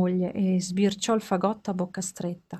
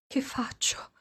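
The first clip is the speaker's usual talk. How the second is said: anxious whisper; whisper to himself; 6 semitones higher, 9 dB quieter, slower than usual. Acted. Carlo at the door whispers to himself, anxious. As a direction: breathe it under your breath. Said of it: "si disse".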